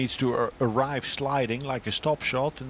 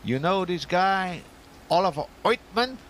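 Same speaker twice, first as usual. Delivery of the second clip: put-on Brooklyn accent; 5 semitones higher than usual. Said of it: "Brooklyn Johnny, they called him".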